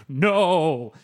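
loud, drawn-out grave refusal; imitated ancient tree's booming verdict; grave proclamation. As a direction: deliver it with mock gravitas. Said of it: "He delivers this with the gravitas".

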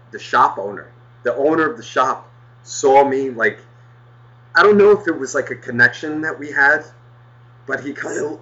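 ordinary delivery

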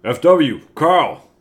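put-on booming boss with German accent; stern studio boss, German accent. [name] plays boss, evil German.

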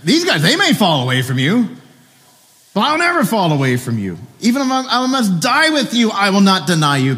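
boastful Peter. Retold or imitated. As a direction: brag that he alone will stand firm. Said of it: "He's like".

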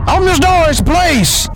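commanding shout. Acted. Cop banging on door.

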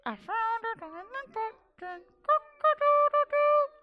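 high sing-song rooster crowing. Acted. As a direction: sing it proud like a little rooster. Rooster sings again.

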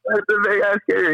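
high-pitched echo of a buddy's exclamation; quoted astonishment. Retold or imitated. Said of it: "I'm gonna quote him".

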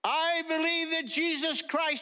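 high, loud confession of sin; praying out loud. Retold or imitated. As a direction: lead the congregation's prayer, loud and high. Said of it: "pray out loud".

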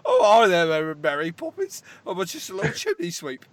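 hammed-up bad Cockney accent. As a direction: do a terrible cheery Cockney sweep.

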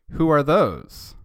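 slow, loud, high cry of alarm; kids shouting. Acted. Schoolkids spotting the hedgehogs, shouting in alarm. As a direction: yell it like startled schoolkids. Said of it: "the kids were all saying".

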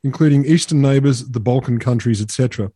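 a light put-on Polish accent, reading the quote; mock Polish accent. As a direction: lightly imitate a Polish spokesman. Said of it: "This is not the Accent podcast".

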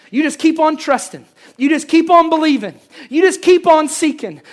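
ordinary delivery

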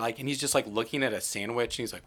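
ordinary delivery

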